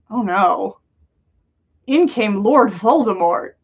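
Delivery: ordinary delivery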